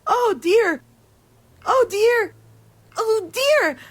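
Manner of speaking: high, loud toddler wail, imitated; a mother mimicking her child's frightened cries; little child screaming.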